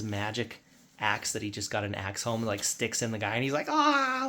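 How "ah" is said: high, loud scream of pain; high-pitched pretend shriek; mock scream. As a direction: imitate a stuck creature's scream. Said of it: "like, screaming".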